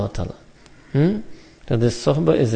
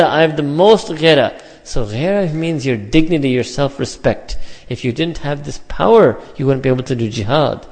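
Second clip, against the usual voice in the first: normal talking voice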